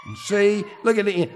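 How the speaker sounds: usual speaking voice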